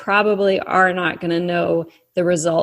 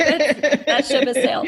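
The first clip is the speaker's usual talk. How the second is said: higher, laughing through the words; laughing mock quote; 7 semitones higher than usual. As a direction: chuckle it out as a joking aside. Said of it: "you're like".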